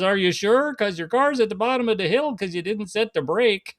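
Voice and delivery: high Minnesota singsong punchline; Sven's Minnesota accent. Acted. Friend pointing out the runaway car, Minnesota accent. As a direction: question him in broad Minnesotan. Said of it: "they literally had the thick Minnesota accents".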